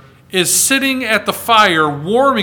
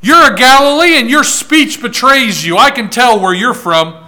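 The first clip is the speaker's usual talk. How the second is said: loud, higher mock accusation, slowing on betrays; accusing shout; 5 semitones higher, 5 dB louder than usual. The crowd at the fire accusing Peter of being a Galilean, loud mock accusation. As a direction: call him out in front of everyone. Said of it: "They said".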